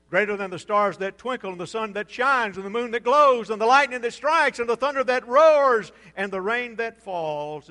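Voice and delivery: rising, rhythmic preacher's roll; building preaching crescendo. Real, not acted. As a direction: drive the list up to a pulpit peak. Preached list building to a peak.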